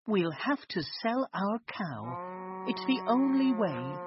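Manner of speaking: lightly voiced worried mother; a hint of an anxious mum; mother's voice. A narrator's reading. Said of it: "she said to him".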